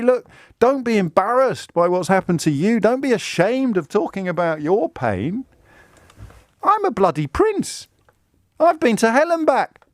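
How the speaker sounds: high, hammed-up royal pep talk; mocking Harry. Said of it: "To say".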